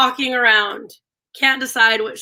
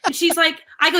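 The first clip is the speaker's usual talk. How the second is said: ordinary talk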